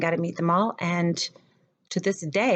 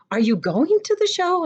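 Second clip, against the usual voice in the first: much higher, lilting imitation; eager question; 12 semitones higher, 4 dB louder than usual. Playwright asks the professor about the show, eagerly. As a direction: pitch the retold question way up.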